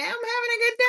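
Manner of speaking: a playful squeak before saying it straight; squeaky voice. Pretend. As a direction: chirp it out in a tiny high voice. Squeaky answer, then repeated plainly.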